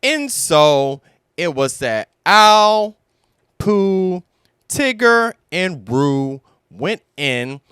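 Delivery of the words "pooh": ordinary delivery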